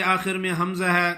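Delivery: normal talking voice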